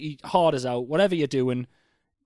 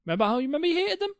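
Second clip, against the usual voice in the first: fast, high, building rant; excited; 11 semitones higher, faster than usual. Real, not acted. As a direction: rattle it off, pitch up. Excited rant about hating people.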